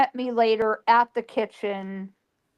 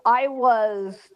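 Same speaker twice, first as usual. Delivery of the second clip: normal talking voice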